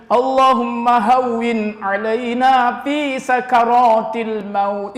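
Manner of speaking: drawn-out Arabic prayer, slow; slow prayer recitation. Acted.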